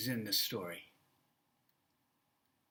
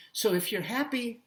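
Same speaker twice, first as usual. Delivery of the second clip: usual speaking voice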